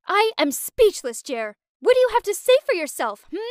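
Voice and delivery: high, loud, fast demanding yell; screaming. Acted. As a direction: scream him down for an answer. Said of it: "Naomi screamed".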